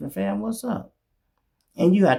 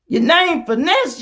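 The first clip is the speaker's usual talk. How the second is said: much higher and louder jeer; loud mocking; 12 semitones higher, 6 dB louder than usual. Real, not acted. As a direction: call him out to his face. Calling out his name.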